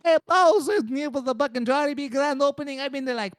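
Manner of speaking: high-pitched Filipino cousin; Filipino accent. Acted. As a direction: chatter excitedly in a caricatured Filipino accent.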